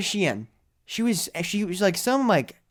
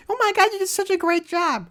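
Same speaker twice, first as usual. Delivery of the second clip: much higher mock gushing praise; gushing churchgoer. Churchgoers praise him after the show, mock gushing. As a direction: gush like an impressed fan. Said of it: "I had people come in".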